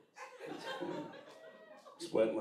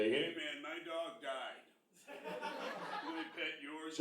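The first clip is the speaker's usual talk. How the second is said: put-on sad drunk dude; 5 semitones higher, 10 dB quieter, much slower than usual. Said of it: "he's like".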